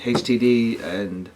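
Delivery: usual speaking voice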